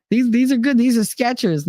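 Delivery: high quoted voice; a light put-on high voice; character voice. Voicing Jeff's friend in retelling.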